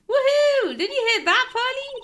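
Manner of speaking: high loud woohoo; cheering. Acted. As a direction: cheer like a thrilled fan. Maya cheering.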